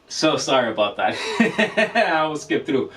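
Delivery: embarrassed chuckle over a slip; laughing apology. Real, not acted. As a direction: laugh off your own mistake. Laughs off own mistake.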